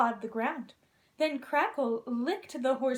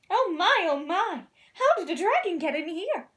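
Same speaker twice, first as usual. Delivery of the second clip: loud, high alarmed king; flustered king yelling; shouting; 4 semitones higher, 5 dB louder than usual. Acted. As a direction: shout in shock at the dragon. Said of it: "he shouted".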